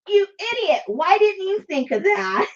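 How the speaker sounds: high, teasing "you idiot"; mock self-scolding. Viewer scolding herself for missing the idea, playful. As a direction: scold yourself in mock disbelief. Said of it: "where you go like".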